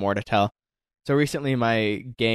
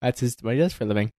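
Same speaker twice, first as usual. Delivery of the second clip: ordinary talk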